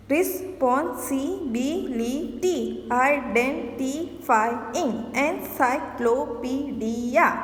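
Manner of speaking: each word stretched slowly, syllables spaced out. Shown as a demonstration. Teacher sounding out example words for the class, slowly. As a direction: sound out every beat for the pupils. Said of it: "More than three syllables".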